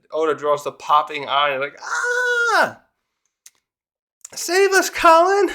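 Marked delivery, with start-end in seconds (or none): 1.8-5.5 very high squealed cry for help; terrified kids. Retold or imitated. Kids begging Colin as beasts stare, high squeal. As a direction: shriek it like a cartoon child.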